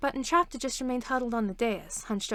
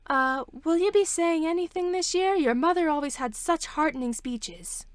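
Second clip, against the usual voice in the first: higher, eager coaxing of the princess; hopeful town leader; 7 semitones higher, 4 dB louder than usual. Acted. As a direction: nudge her to give a speech.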